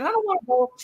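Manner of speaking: mock whine of a reluctant old lady; whiny sister. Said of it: "And she said".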